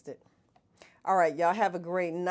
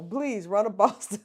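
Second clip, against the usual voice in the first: ordinary talk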